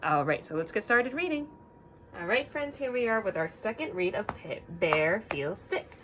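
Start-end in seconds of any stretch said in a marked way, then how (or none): none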